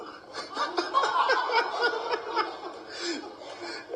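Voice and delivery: high breaking laugh. Real, not acted. Eulogy speaker laughing at his coach impression, high laugh. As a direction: laugh through tears.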